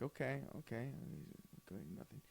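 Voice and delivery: barely audible okays, trailing off; muttered waiting. Retold or imitated. Waiting at a silent speaker, faint mutter. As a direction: mumble impatiently to yourself.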